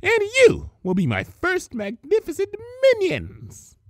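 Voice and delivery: high, loud grandiose villainess; villainous declaration. Acted. Villainess announcing her plan to captive unicorns, loud and grand. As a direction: proclaim your triumph to the captives.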